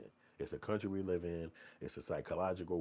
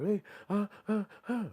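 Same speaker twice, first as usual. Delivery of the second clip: mock helpless stammer; 12 semitones higher, 3 dB louder than usual. Mocking a victim stammering.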